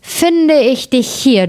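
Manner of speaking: high, drawn-out exclamation; hunter's discovery. A narrator's reading. Hunter finds the wolf at last, high and drawn out.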